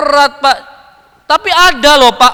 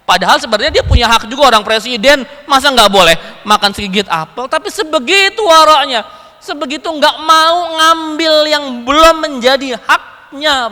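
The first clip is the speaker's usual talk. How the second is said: ordinary talk, nothing marked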